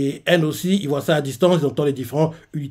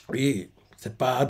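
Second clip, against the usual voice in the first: ordinary talk, nothing marked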